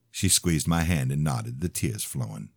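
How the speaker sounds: ordinary delivery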